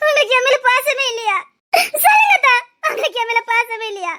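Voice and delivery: sobbing, pleading question; childlike crying. Acted. Woman asks her lover whether he cares for her, crying. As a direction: beg for an answer through sobs. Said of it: "சின்ன பிள்ளை மாதிரி அழுதுட்டு இருக்காத".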